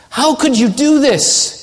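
high, drawn-out appalled demand; outraged master's rebuke; disgusted. Acted. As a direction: demand it in disgust, pitched well up. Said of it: "Do you see the disgust that he has".

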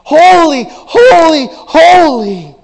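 loud, high, slow crying shout; seraphs crying out. Acted. Preacher voicing seraphim crying holy to one another, shouting. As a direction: cry it out like the seraphim.

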